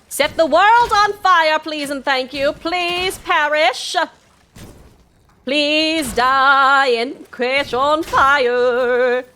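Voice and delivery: slow, high, loud mock-sweet taunt; gleeful taunting. Real, not acted. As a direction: savour the enemies' demise. Taunting enemies in game.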